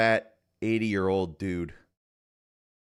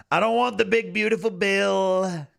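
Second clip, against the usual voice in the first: Trump impression; 8 semitones higher, 4 dB louder than usual. Streamer demanding a Netflix special instead, Trump voice. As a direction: boom it out as a Trump parody.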